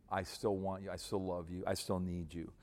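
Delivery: soft, low loving assurance; tender reassurance. Shown as a demonstration. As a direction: reassure a wife gently and sincerely. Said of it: "Be more reassuring of your love".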